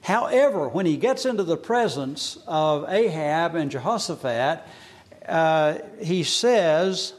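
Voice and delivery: usual speaking voice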